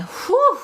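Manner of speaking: high sharp whew of relief; high exhaled whew. Real, not acted. Release after channelling.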